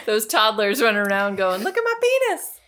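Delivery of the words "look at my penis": put-on high-pitched little-kid holler; mock childish sing-out, way up high; toddler impression. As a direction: crow it like a naughty toddler. Mimics gleeful toddlers running around.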